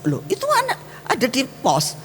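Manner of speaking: high, incredulous "didn't you see?"; indignant question. Retold or imitated. Preacher voicing her own irritation at the security guard, high and incredulous. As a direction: squeak up in disbelief at the guard. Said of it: "mata saya bilang".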